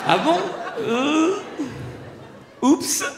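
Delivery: high, sheepish "oups"; hammed-up guilty surprise; mock surprise. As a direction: play it caught out and embarrassed. Caught-out parent reply.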